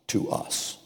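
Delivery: hushed aside; whispered emphasis. Acted. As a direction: whisper the words to us.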